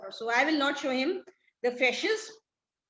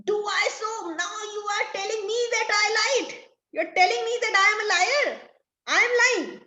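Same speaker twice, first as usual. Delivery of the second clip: much higher, rising outrage; furious disbelief at being called a liar; outraged; 9 semitones higher, 3 dB louder, faster than usual. Acted. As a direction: Stefano to Trinculo, outraged and incredulous. Stefano enraged at being called liar.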